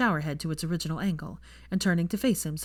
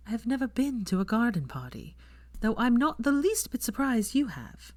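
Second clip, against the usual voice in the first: put-on a touch higher, young woman's worried voice; mild higher female character voice; Hermione, lightly voiced; 6 semitones higher than usual. A narrator's reading. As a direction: lightly lift the voice into a wary Hermione.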